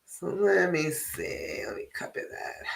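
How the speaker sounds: unvoiced murmur while reading the screen; whisper to herself. Real, not acted. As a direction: breathe the words to yourself as you read.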